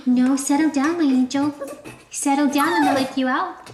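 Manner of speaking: high, sing-song talk to a puppy. Real, not acted. Reassuring the puppy in his crate, high baby talk.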